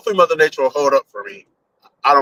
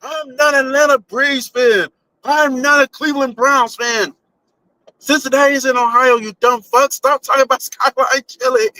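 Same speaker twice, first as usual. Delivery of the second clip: high-pitched, exasperated yell at the trolls; mock yelling; 11 semitones higher than usual. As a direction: yell it in a high, hammed-up outrage. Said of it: "just yelling at 'em like".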